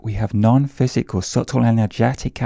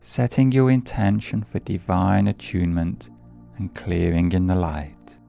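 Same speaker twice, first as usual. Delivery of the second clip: guided meditation. Acted. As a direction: ease them gently into the breath. Leads a meditation.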